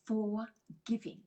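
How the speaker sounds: "forgiving" said slowly as "for... giving"; split into two parts. Shown as a demonstration. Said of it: "It's broken up into two parts".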